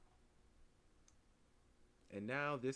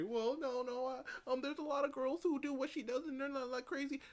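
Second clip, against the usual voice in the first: much higher mocking voice of a defensive listener; mock objecting listener; 12 semitones higher, 3 dB louder than usual. Host mocking a listener who defends her: high mocking voice. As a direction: squeak out the objection like a whiny defender. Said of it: "You can say".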